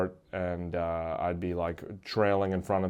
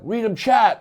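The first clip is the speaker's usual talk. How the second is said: urgent demand; 11 semitones higher, 5 dB louder than usual. Retold or imitated. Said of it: "people are like".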